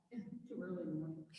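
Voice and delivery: mock class whisper "Too early in the morning"; teacher whispering for the silent class. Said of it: "Have I freaked you all out?".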